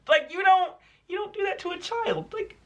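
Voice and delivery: high-pitched disbelief; incredulous protest. Real, not acted. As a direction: squeak it out, baffled and appalled. Outraged disbelief at teacher's behavior.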